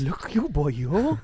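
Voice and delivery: bad, hammy Welsh accent, high and loud; mock Welsh, lilting and overdone. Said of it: "That was a terrible Welsh accent".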